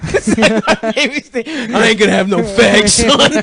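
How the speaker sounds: hammed-up macho country father. Mocking a macho redneck dad.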